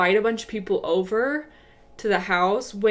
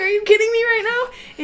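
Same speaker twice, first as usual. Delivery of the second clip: exasperated yell; 12 semitones higher, 4 dB louder than usual. Retold or imitated. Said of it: "I'm like".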